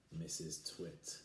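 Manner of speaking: ordinary delivery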